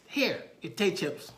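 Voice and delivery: put-on high, loud, slow child voice; small child offering something; little boy. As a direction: present it proudly like a small kid. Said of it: "little man".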